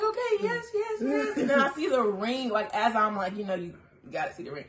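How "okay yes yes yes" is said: high, breathless yeses; accepting the proposal, a high excited gush. Retold or imitated. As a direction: gush yes over and over.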